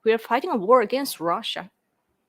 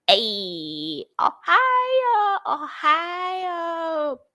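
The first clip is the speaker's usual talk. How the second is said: much higher, wailed ohio ohio; hammed-up greeting; 10 semitones higher, much slower than usual. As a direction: squeal the greeting like a cartoon.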